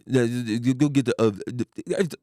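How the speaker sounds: loud, garbled Southern country mumbling; drunk country mumble. Retold or imitated. Imitating drunk Mississippi dad giving an order, country mumble. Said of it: "but he mumbles more".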